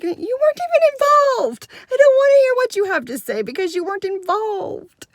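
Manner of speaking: high-pitched, exaggerated exasperation; exasperated retort. Teacher shutting down an uninvolved student, exasperated. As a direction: cut the kid off in a loud, high complaint.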